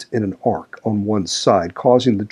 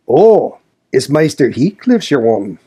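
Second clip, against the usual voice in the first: put-on raised, higher old Yorkshire servant's voice; crying out as old Joseph; 4 semitones higher, 4 dB louder than usual. A narrator's reading. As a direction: blurt it out in broad Yorkshire. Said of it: "cried he".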